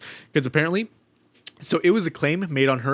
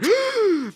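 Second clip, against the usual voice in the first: mock gasp. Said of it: "I was like".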